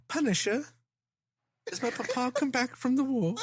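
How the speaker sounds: put-on high squeaky kid voice; whiny little boy asking; child voice. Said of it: "But he did".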